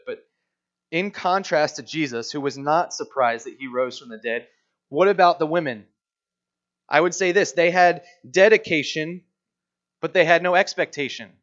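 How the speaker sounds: ordinary talk, nothing marked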